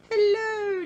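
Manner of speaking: very high, loud hello; delighted greeting. Acted. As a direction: call out gladly to the cousin. Mister Greedy greets cousin.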